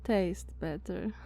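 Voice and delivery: usual speaking voice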